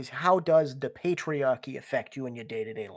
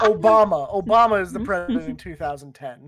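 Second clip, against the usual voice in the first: loud; 4 semitones higher, 13 dB louder than usual. Pretend. Loud joking answer.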